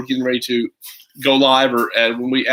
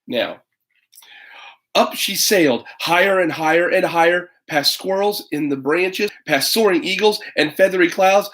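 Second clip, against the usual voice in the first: storybook narration that climbs higher as she flies; lively story reading; 5 semitones higher, slower than usual. Acted. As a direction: build the wonder as she sails up. Dramatic picture book reading.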